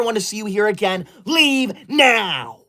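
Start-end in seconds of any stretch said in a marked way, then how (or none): 1.2-2.6 mock stern dismissal. Banishing the viewer from stream.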